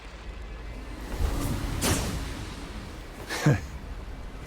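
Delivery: villain laugh. Acted. Villain mocking the heroes.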